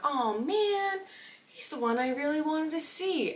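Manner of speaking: high, slow sing-song oh no; mock disappointed tourist. Imitating the Midwest family in a high put-on voice. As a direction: mimic a sweet out-of-towner's dismay.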